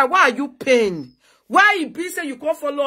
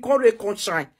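ordinary talk, nothing marked

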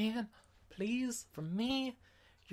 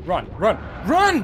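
loud, climbing to a high yell; frantic command to run; urgent shouting; 3 semitones higher, 9 dB louder, slower than usual. Real, not acted. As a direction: shout it as you flee. Fleeing the boss.

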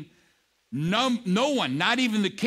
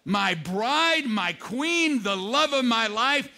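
slow, stretched, higher accusation; king turning on Haman; furious accusation; 6 semitones higher, slower than usual. Retold or imitated. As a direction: drag out each word in rising fury. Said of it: "And he was furious".

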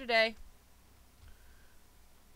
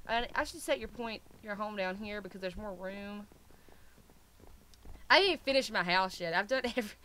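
normal talking voice

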